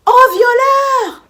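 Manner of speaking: high, drawn-out shout of accusation; loud cry. Retold or imitated. Waking up and crying out at the intruder, loud cry. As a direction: yell it for the whole house to hear. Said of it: "du coup, je crie".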